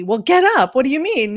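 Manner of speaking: mocking someone nagging a sick relative; mock impatience. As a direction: push a tired person to get moving. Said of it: "you wouldn't say".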